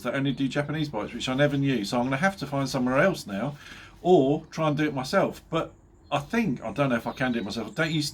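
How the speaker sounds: ordinary talk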